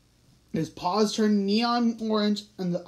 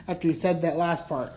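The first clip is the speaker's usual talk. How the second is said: ordinary delivery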